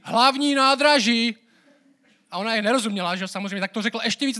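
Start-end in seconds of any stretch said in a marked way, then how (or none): 0.0-1.4 high, very loud, slow drawn-out yell; imitated shout. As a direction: bellow each word slowly at someone who doesn't understand. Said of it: "A on na ní zakřičel česky".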